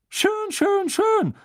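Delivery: very high, drawn-out gushing; Fat Lady voice. Acted. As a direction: coo it like a nosy painted lady. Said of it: "sagte die fette Dame".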